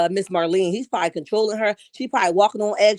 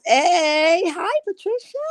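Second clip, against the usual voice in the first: excited greeting; 8 semitones higher, slower than usual. Real, not acted. Greeting viewer in sing-song.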